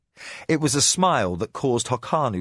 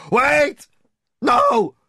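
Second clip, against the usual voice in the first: very high, loud terrified scream; 7 dB louder than usual. Acted. Prisoner screaming at threat of insects, terrified. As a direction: scream in panic. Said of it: "Korbach screamed".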